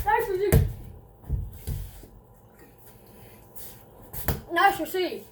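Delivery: loud and high, repeated; happy shout "Nice machine!"; excited cheer. Real, not acted.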